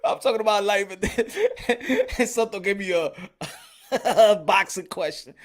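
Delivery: real laughter breaking the words; laughing through words. Real, not acted. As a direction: talk while cracking up. Laughing while speaking.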